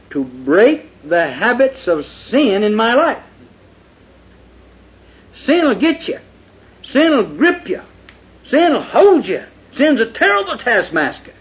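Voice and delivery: high, loud pulpit preaching; fiery preaching. Acted. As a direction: thunder it from the pulpit. Preaching at full force.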